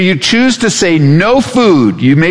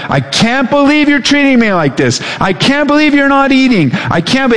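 high, put-on complaining voice of the body; mock-indignant body; whiny body voice; 10 semitones higher than usual. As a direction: whine like an offended stomach. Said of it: "your body is just like".

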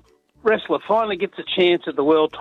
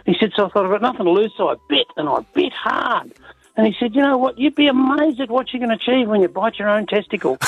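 higher-pitched, mildly excited joke-telling; acting out an amazed exchange, pitched up; excited retelling; 8 semitones higher than usual. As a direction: lift the pitch as you build to the punchline. Acts out excited wrestler after win.

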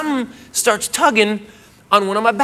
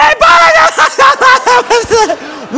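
high, loud mock laugh; imitated wheezing laugh; 14 semitones higher, 6 dB louder than usual. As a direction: howl with laughter. Girlfriend laughing can't breathe.

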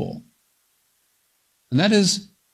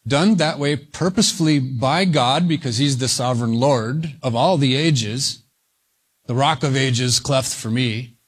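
ordinary delivery

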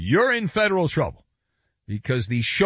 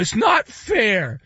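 higher, drawn-out mock complaint; whining fanboy; 6 semitones higher, slower than usual. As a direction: whine like an aggrieved fan. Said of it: "And they're like".

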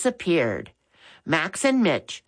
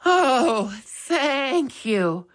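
relieved sigh; 7 semitones higher, much slower than usual. A narrator's reading. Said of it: "Missus Baker sighed".

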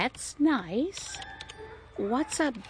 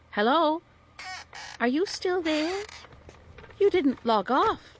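higher-pitched, insistent hello; calling out; 6 semitones higher than usual. Acted. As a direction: call into a silent line to check. Calls again to silent computer.

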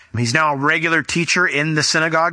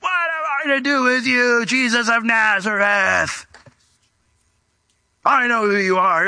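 high shouted cry, then slow demonic words; a demon screaming from a man; 8 semitones higher, slower than usual. Retold or imitated. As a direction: rage at Jesus like a cornered demon. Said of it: "this guy goes".

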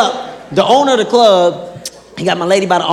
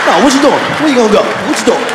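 mock heavy; 3 semitones higher, faster than usual. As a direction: press him hard. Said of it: "Wayne was like".

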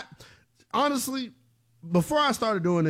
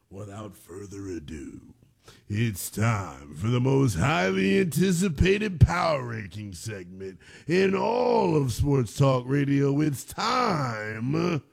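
segment announcer; 5 semitones lower, slower than usual. Acted. Host introducing his power ranking segment, slow grand announcer. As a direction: stretch it out like a ringside announcer.

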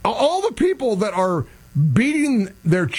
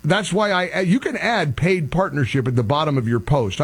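normal talking voice

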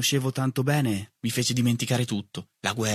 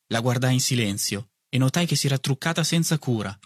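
usual speaking voice

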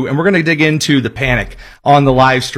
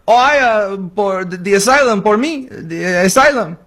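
deliberately bad fake Mexican accent, pitched up; 4 semitones higher than usual. Host mocking an asylum claim, bad Mexican accent. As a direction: ham up a terrible Mexican accent. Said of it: "a really bad Mexican accent".

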